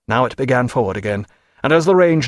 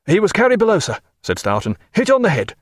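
raised higher report voice; other officer; 7 semitones higher than usual. A narrator's reading. Officer answering the captain, higher voice. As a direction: report the wounded briskly.

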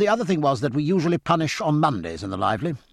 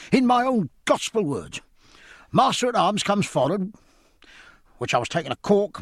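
1 trembling fury; 8 semitones higher than usual. Acted. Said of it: "pale and trembling with fury".

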